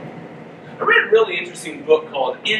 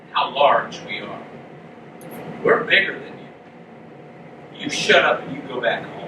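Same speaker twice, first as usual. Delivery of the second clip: lower, threatening tone; a hint of a bully's threat; menacing men; 4 semitones lower than usual. Tribe threatening Micah, a lightly voiced low menace. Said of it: "He said".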